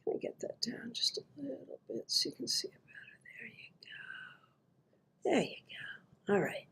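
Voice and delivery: very soft, hurried whisper; whispered aside. Real, not acted. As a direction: murmur it quickly to yourself while busy. Aside while adjusting the card view.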